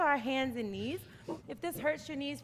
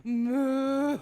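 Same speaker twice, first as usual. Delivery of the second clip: long playful moo; cow moo; 3 dB louder than usual. Shown as a demonstration. Teacher not hearing kids moo, drawn-out moo. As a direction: moo it out for them to copy. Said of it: "I don't hear you mooing".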